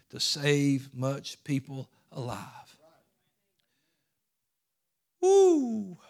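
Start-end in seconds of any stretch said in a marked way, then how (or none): 5.2-6.0 high loud whoop of praise; preacher's ecstatic whoop; joyful whoop. Real, not acted. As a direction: let out a joyful woo. Preacher's shout of joy.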